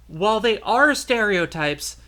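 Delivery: ordinary delivery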